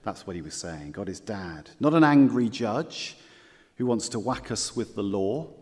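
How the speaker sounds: ordinary delivery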